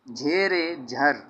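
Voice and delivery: very slow, each syllable apart: झे, रे, झर; slow spelled-out demo. Shown as a demonstration. Teacher showing students how to read it, very slowly. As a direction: drag out झे रे झर so students can follow. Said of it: "इसको ऐसे पढ़ना".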